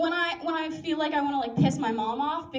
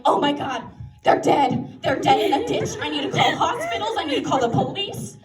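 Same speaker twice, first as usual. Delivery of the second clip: louder, half-laughing mock panic; frantic parent; 6 dB louder than usual. Panicked parent running through the house, mock frantic. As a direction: rattle it off like a parent imagining the worst. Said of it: "frantic and upset that parents get".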